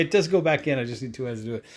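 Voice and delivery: ordinary talk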